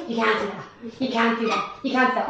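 put-on low, soft teen scolding; a light take on her girls' protest; mocking her girls. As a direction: imitate the daughters telling her off. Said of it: "they're like".

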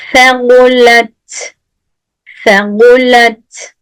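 "Segulet" slow and lisped; slow lisped recitation. Shown as a demonstration. Teacher reciting "Segulet" slowly for students. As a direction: draw out "Segulet" with the lisp.

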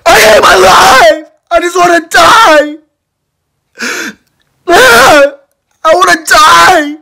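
high, loud wailing sobs; crying. Acted. Boy sobbing loudly after being kicked out. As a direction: wail and sob at the top of the voice. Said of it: "Yeah, I was crying".

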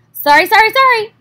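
high loud hurried apologies; flustered goose. Acted. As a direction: fluster out the sorries. Gander apologizing in a flurry.

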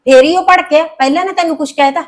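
mother raising voice at daughter. Acted. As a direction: hit back with the fact. Mother defends brother.

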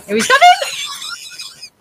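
high drawn-out scared shout; terrified shout. Real, not acted. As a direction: shriek it out as he charges. Monkey charges in horror game.

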